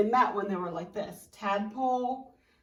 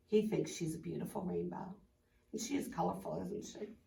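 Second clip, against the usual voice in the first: ordinary delivery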